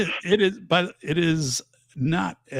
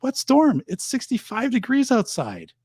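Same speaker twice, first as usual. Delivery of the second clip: incredulous retort; 11 semitones higher than usual. Retold or imitated. Teacher retorts to nervous student.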